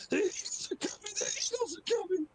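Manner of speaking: squealed alarm, very high falsetto; tiny high-pitched shriek without much extra volume; mock scream. Player shows the game master the full alarm, screaming. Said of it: "So I'm doing the full".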